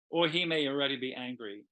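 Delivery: ordinary delivery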